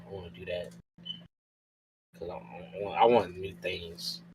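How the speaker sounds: ordinary talk, nothing marked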